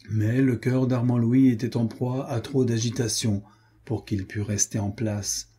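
plain reading voice

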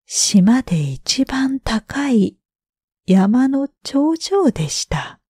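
usual speaking voice

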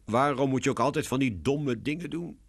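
mildly despairing question; sighing complaint. A narrator's reading. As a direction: lament your sister's foolishness. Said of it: "zucht Lionel".

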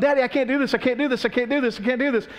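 acting out a kid's anxious plea; panicky child. Frightened daughter to daddy running behind her, panicky protest.

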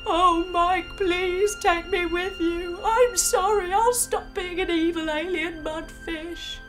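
villain begging to be saved; sorrowful plea. Acted. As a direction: plead with sorrow and hope. His eyes fill with sorrow and hope.

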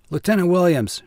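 shouted. A narrator's reading. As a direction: call him from a group of officers. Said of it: "he shouted".

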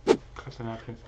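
talking through a mouthful of food; words around a bite of wings; mouth full. Real, not acted. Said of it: "eating with my mouth full of food".

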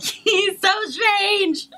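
high, drawn-out exclamation; amused exclamation. Real, not acted. Wife reacting to her husband's silly voices, high amused cry.

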